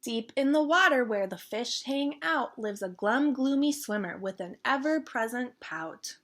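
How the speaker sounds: put-on lively storytime read-aloud for kids; bouncy children's book reading; reading a picture book aloud. A narrator's reading. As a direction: read it like storytime for little ones. Reads picture book to viewers.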